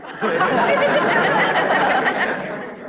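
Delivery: high airy ghoulish laugh; eerie breathy cackle; creepy undertaker chuckle. Acted.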